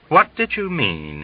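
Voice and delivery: cagey colonel easing into the question, slow. Acted. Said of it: "he inquired slowly".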